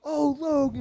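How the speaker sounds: high, excited call of his name; surprised happy hello; delighted greeting. Retold or imitated. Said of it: "he goes".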